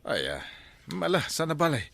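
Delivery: normal talking voice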